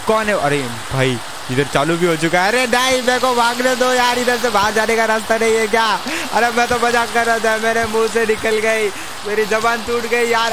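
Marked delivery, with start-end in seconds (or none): 2.5-10.5 high-pitched mock-panicked pleading; playful panic. Streamer begs other players to let him escape, playfully panicked.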